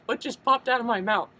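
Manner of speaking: high, loud, baffled reaction; mock astonishment. Retold shock at own accent.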